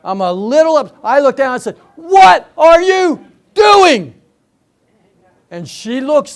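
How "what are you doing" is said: loud, high and very drawn-out, each word separate; slow incredulous shout; annoyed yell. Retold or imitated. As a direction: holler down at your wife, exasperated, word by word. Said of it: "but I'm annoyed".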